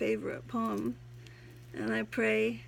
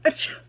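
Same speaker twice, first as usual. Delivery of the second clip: fake sneeze; 9 dB louder than usual. I'm a little allergic to that stuff.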